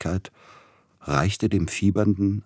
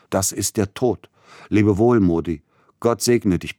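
higher, louder farewell cry; crying out in anguish; 4 semitones higher, 5 dB louder than usual. A narrator's reading. Sick man bids farewell in a heart attack, crying out. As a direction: cry out as death seizes you. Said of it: "und ihn schreien machen".

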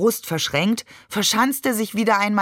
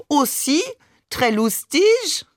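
high, teasing mock French; swooping French-accented question; exaggerated French accent; 8 semitones higher, slower than usual. A narrator's reading. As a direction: flutter through it in an overdone French accent. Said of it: "ahmte Barbe übertrieben Mamans französischen Akzent nach".

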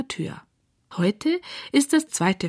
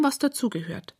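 usual speaking voice

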